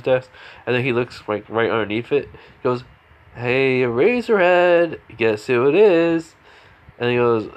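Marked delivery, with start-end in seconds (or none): 3.3-6.4 loud, high, drawn-out singsong call; playful wake-up call. Acted. Taskmaster finds Aizawa asleep under the desk, loud teasing call. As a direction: call out slowly and teasingly, pitched up.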